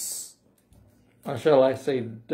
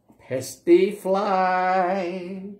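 higher, slow, stretched PESTY FLY; drawn-out question; 5 semitones higher, much slower than usual. Acted. Reader asks children the rooster's question, drawing it out. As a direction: hold "fly" long as it lifts.

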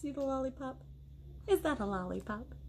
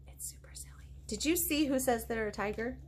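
ordinary delivery

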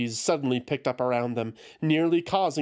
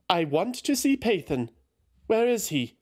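high, pleading demand; insistent Eothas; 7 semitones higher than usual. A narrator's reading. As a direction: press to see him, pitched up.

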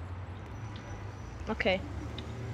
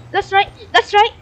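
squeaky, repeated taunt; high, chanted self-congratulation; high-pitched gloating; 9 semitones higher, 4 dB louder than usual. Pretend. Gloating during the game.

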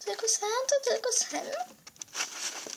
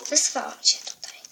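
sinister whisper. Acted. Villain reveals plan.

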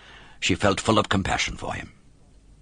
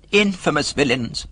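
high-pitched cat exclamation; cat's higher, theatrical condemnation; echoing indignant cat; 7 semitones higher than usual. A narrator's reading. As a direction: lift the voice and echo the outrage. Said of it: "repeated the cat".

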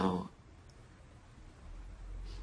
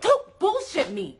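high, loud, slow and emphatic warning; furious raised voice; angry outburst; 10 semitones higher, 5 dB louder, slower than usual. Acted. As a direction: snap it at them, high and loud. Heated quarrel, she snaps.

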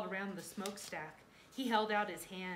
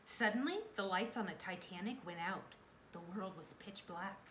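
plain reading voice

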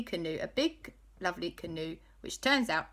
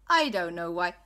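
plain reading voice

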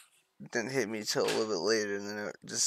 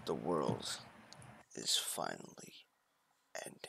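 deeper dramatic narration; 11 semitones lower, 13 dB quieter than usual. Pretend. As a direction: drop to a grave hush. Repeated doom line, deeper.

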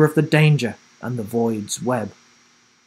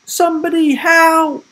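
loud, high-pitched call for help; shouted plea; 6 dB louder than usual. A narrator's reading. Will calling out from the cage, shouting. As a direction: shout for anyone to come. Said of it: "Will shouted".

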